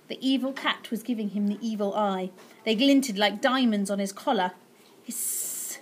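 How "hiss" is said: unvoiced cat hiss. The evil cat warning the boy, hissing. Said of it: "it hissed".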